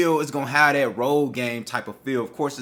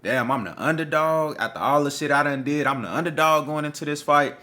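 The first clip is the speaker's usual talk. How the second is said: mock disbelief, pitched higher; higher-pitched, mildly put-out underdog; Errol Spence's indignant inner voice; 5 semitones higher than usual. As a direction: lightly act out a slighted fighter's thoughts. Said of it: "does something extra to his psyche".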